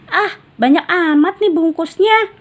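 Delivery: put-on high, complaining child; grumbling. Raihan grumbles at the many wrappings, high. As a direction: whine about the wrappings. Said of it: "gerutu Raihan".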